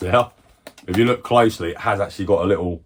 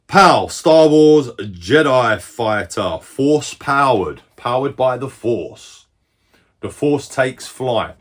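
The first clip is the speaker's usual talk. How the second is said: slow, louder mock movie trailer read; trailer announcer; 5 semitones higher, 7 dB louder, much slower than usual. Collector reading the Jedi Fighter box as a slow trailer voice. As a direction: boom out the box tagline like an epic trailer.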